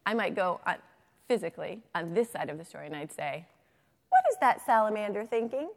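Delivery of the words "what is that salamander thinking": higher, louder, rising question; exaggerated storytime wondering; teacher's prompting question. Shown as a demonstration. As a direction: ask the children with big curiosity. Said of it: "put my head right next to the salamander".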